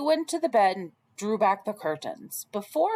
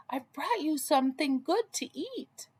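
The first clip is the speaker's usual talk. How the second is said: put-on wolf faking a higher, sweet girl's voice; 7 semitones higher, 3 dB quieter than usual. Wolf at Grandma's door faking Red's high, sweet voice. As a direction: pretend to be the little girl, sugary. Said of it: "called Wolf in a high, sweet voice".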